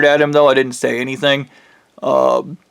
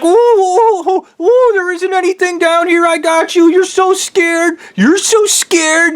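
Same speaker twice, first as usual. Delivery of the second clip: mocking friend. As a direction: taunt him in a shrill falsetto mockery. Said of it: "he's like".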